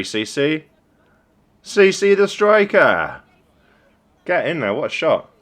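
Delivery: loud, high celebratory shout, drawn out on the name; excited cheer. Real, not acted. Coach cheering C C the striker's shot, excited cheer. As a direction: cheer the goal at full voice, pitch soaring.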